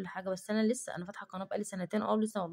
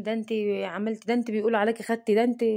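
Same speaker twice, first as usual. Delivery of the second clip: nagging gossip; 3 semitones higher, 8 dB louder than usual. Retold or imitated. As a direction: mimic people hounding her everywhere, loud. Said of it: "كل ما امشي في مكان". (تقليد ساخر لكلام الناس بصوت عالي)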